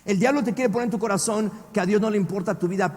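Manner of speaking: ordinary talk, nothing marked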